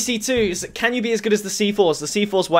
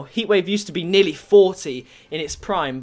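ordinary talk, nothing marked

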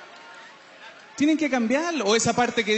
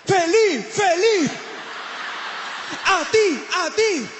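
overeager party guy; 7 semitones higher, slower than usual. Retold or imitated. Party guy shouting to keep the birthday going. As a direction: pump up the party with a yell. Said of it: "Son esos que dicen".

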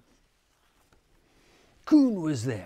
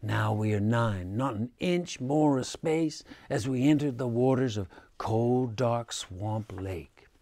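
usual speaking voice

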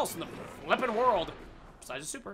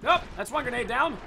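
usual speaking voice

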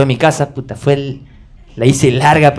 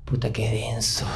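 much softer, muttered; heavy sigh; 3 semitones lower, 13 dB quieter than usual. Real, not acted. Recalling the painful moment, very soft. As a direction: let the weight land. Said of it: "heavy moment".

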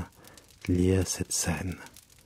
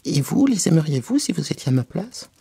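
high, indignant mouse; piercing, angry voice; 8 semitones higher, 4 dB louder than usual. A narrator's reading. The mouse challenges Alice about liking cats, high and angry. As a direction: challenge her sharply, pitched high. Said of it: "d'une voix perçante et colère".

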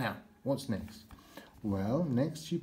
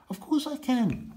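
much higher and louder, bursting with eagerness; eager squeal; 5 dB louder than usual. Pretend. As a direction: squeal out a keen yes. Toy eagerly agrees to help.

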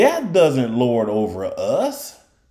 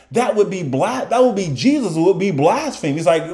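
ordinary talk

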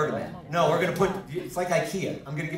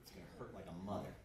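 much softer, lower, fast confidential aside; hushed warning; 8 semitones lower, 22 dB quieter, faster than usual. Acted. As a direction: lean in and drop it to a murmur. Hushed joke to volunteer.

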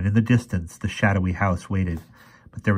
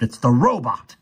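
higher, louder cry of alarm; gasping alarm; 5 semitones higher, 5 dB louder than usual. A narrator's reading. Professor identifies the robot, gasping in alarm. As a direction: blurt it out in shock. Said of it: "he gasped".

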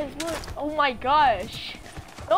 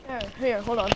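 normal talking voice

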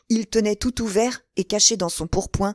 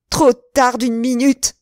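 high, loud, slow wail; despairing cry; 5 semitones higher, 7 dB louder, slower than usual. A narrator's reading. De Winter tears his hair, crying out in despair. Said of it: "s'écriait-il".

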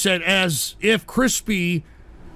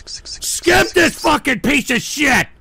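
slow, higher, hard-punched order; angry shout; 4 semitones higher, slower than usual. Real, not acted. Streamer demanding a donation be skipped, angry shout. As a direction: yell it out word by word.

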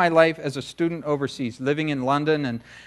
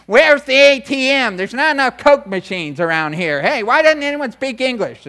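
loud ugly American; 8 semitones higher, 6 dB louder than usual. Retold or imitated. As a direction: ham up a loud rude tourist. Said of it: "that obnoxious person, you know, who's just, like, loud".

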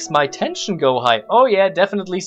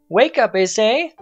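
mom calling; 6 semitones higher, 4 dB louder than usual. Acted. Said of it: "Mom's voice coming from the stairs".

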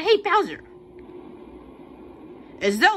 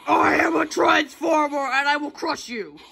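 Transformer villain; 7 semitones higher than usual. Acted. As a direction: threaten like a giant robot. Voicing a robot villain.

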